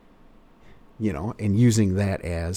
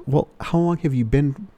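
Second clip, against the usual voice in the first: car salesman question; 6 semitones higher than usual. Shown as a demonstration. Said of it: "question for open car sales".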